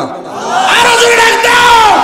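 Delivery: high, drawn-out shouted call; rousing shout. Real, not acted.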